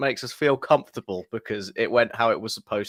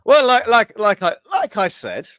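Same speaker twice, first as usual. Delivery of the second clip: Boris Johnson, hoity-toity English. Acted. Co-host recapping England's defeat for the fans, hoity-toity English accent. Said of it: "some sort of weird Boris Johnson".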